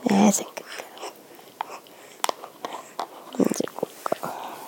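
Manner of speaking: soft unvoiced whisper; whispered praise. Real, not acted. Mother praising baby as she eats, whispering. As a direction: coo the praise softly.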